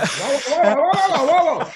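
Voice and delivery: high, loud, rising alarm; mock panic at a friend; alarmed whoa. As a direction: yell whoa in sudden alarm. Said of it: "and he goes".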